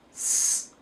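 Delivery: "s" as a drawn-out unvoiced hiss, not the letter name; sounding out letters. Shown as a demonstration. Teacher sounding out a word to be written, "s" as a hiss. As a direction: hold "s" as its phonics sound. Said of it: "hopefully they will write".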